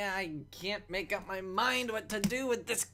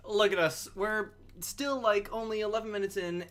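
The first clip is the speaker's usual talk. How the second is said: ordinary talk, nothing marked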